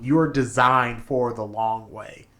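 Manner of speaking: usual speaking voice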